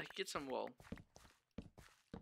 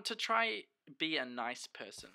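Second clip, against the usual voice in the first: ordinary talk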